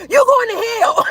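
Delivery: high, mock holler; hollered damnation. Niece retells mad uncle's outburst, mock hollering. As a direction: holler it like an enraged relative, mimicked. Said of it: "he just hollered out".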